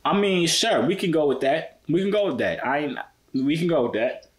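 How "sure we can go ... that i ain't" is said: ordinary talk, nothing marked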